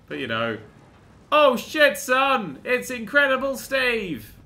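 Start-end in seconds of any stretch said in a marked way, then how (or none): 1.3-4.3 high, loud hyped-up announcement; excited exclamation. Acted. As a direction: shout out the hero's arrival. Mock announces the Hulk's arrival.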